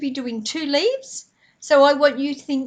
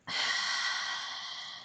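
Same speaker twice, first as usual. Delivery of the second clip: long breathy sigh out; demo sigh; 12 dB quieter than usual. Shown as a demonstration. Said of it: "make a big sigh sound like this".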